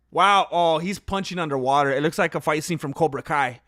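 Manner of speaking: usual speaking voice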